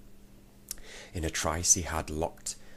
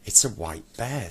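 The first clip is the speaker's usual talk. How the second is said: a mild touch of fright, louder; half frightened; 4 semitones higher, 7 dB louder than usual. A narrator's reading. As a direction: blurt it out a bit scared. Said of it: "half frightened".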